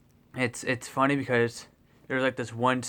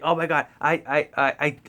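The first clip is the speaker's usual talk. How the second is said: starstruck stammer; 4 semitones higher, 5 dB louder than usual. Retold or imitated.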